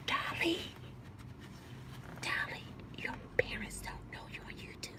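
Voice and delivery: gasping, shocked whisper; whispering about a viewer's secret channel. Real, not acted. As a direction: hush it like a secret. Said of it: "why am I whispering?".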